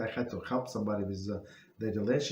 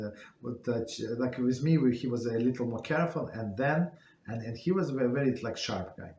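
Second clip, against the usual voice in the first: ordinary delivery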